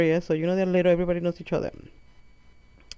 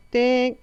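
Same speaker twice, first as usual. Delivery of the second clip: high, loud "ding"; sudden high-pitched fail signal; imitated buzzer ding; 6 semitones higher, 5 dB louder than usual. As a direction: ring it out like a game-show bell. Imitating a quiz buzzer for wrong answers.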